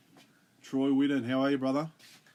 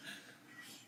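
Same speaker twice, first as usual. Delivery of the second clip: laughing; 4 semitones higher, 4 dB louder than usual. Real, not acted. Joking about inflating viewer numbers.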